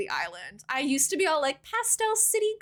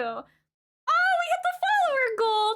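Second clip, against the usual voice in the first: excited high squeal; 13 semitones higher, 3 dB louder than usual. Real, not acted. Reaching the follower goal on stream.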